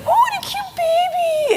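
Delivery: put-on very high, slow baby-talk coo; cooing over a baby. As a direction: gush over the baby like a doting clerk. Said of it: "gooing and gawing over the baby".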